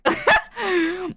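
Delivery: high giggling laugh; cracking up at own cringe writing; burst of laughter. Real, not acted. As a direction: break into helpless laughter.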